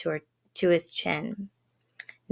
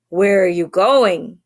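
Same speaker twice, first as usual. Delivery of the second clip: growled; 7 dB louder than usual. A narrator's reading. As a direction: growl the question like a wolf. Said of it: "growled the wolf".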